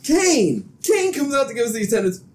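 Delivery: high, exclaimed disbelief; incredulous outburst. Real, not acted. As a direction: launch into a high-pitched rant. Incredulous rant about Kane appearing.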